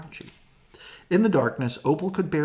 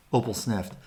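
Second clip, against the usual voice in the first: plain reading voice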